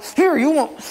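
high-pitched, enraged Moses; hammed-up angry outburst; acted anger. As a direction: lash out at the crowd in a high voice. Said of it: "He was doing it out of anger".

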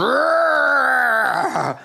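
hammed-up posing roar; mock flex roar. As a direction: roar like a bodybuilder. Muscle man kicks leg to camera.

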